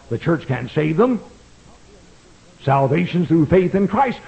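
ordinary talk, nothing marked

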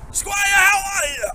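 high slow courtly shout; mock game character hollering; hammed-up shout. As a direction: call out to the squire, drawn out. Shouting greeting in NPC voice.

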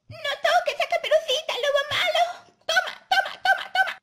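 very high, loud, fast scolding shouts; furious grandma. Acted. Grandma beating the bad wolf, furious shouting. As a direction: shout each blow in a high granny voice.

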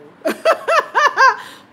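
high, loud burst of laughter; real helpless laugh. Real, not acted. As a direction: crack up at your own joke. Laughing at own impression.

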